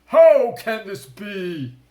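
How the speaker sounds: furious high shout of a shark king; roaring in fury; raging. A narrator's reading. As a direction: rage at being tricked.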